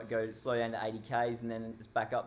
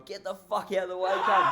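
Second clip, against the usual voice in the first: loud, high yell out the window with a laugh; mock yell; 6 semitones higher, 6 dB louder than usual. Truckie yelling at the man with the stop sign, loud and high. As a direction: shout him off the road, half laughing.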